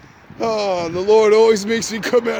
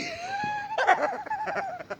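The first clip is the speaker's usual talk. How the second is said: shrieking laugh; 6 dB quieter than usual. Real, not acted. Laughing at the memory.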